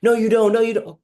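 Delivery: loud, high snapping denial; shouted "No, you don't" in a quarrel; angry retort. Acted. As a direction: fire it back, refusing to believe. Loved one rejects the reassurance.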